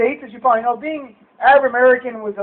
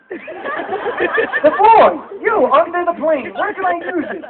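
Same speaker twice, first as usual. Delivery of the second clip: baffled; 5 semitones higher, 3 dB louder, much slower than usual. Retold or imitated. Puzzling over the odd phrase.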